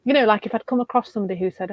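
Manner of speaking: usual speaking voice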